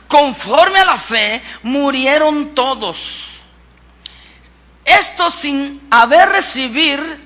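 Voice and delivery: emphatic scripture reading from the pulpit; predicación enérgica. Real, not acted. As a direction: preach it out forcefully, stretching key phrases. Predica leyendo la Biblia. (lectura bíblica proclamada con fuerza)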